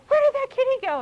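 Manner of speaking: mother playing a kitty game with a child, singsong; playful toddler talk. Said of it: "and I go".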